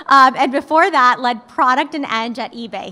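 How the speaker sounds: ordinary delivery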